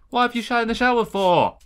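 high, loud indignant question; appalled outcry. Real, not acted. Gamer scolds whoever fouled the shower, loud and appalled. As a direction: demand it in appalled disbelief. Disgusted at mess in game.

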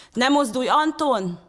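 loud, high urgent command; bellowed warning. A narrator's reading. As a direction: shout to stop him in his tracks. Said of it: "ordította Manfred".

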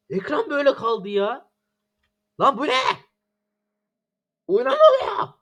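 high-pitched exasperated complaining; venting real annoyance at the lag; frustrated outburst. Real, not acted. Lag frustration rant while gaming. (sinirli ve isyankâr söylenme)

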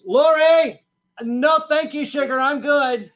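very high, loud shout back; honky voice. Retold or imitated. Husband yelling down to his wife, honky voice. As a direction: holler it back in a high honking voice. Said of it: "I fired back with my honky voice".

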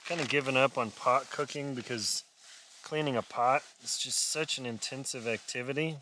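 normal talking voice

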